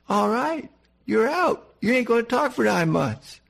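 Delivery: high, brusque brush-off; mock dismissal. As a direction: cut him off with a curt mock verdict. Imagined curt dismissal.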